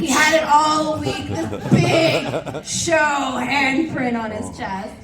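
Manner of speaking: drawn-out, laughing emphasis. Real, not acted. Telling the audience the punchline, slow laughing emphasis. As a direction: stretch it out slowly while laughing.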